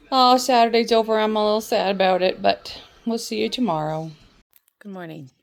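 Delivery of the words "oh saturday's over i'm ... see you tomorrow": loud, bright goodbye to viewers; loud sign-off. Real, not acted. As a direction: call out the farewell. Calling out to viewers.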